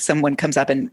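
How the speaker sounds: ordinary delivery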